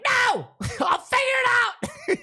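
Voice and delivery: very high laughing shout; shouted refusal. Retold or imitated. Refusing Kyle and Troy's help with a high laughing shout. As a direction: yell it high while cracking up.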